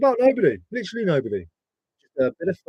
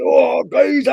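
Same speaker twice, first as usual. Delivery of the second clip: loud Cockney geezer call, pitched up; Cockney shout; 9 semitones higher, 7 dB louder, slower than usual. Retold or imitated. Greeting a mate in a loud Cockney voice. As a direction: bellow it like a London geezer, Cockney. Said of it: "especially when you do me and being Cockney".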